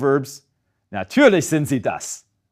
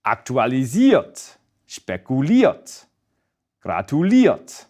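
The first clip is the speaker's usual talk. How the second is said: slow demo with the final "iert" stressed in Aktualisiert, spekuliert, gratuliert; measured Aktualisiert, spekuliert, gratuliert, each with end stress; stress off first syllable; slower than usual. Shown as a demonstration. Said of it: "not stressed on the first syllable".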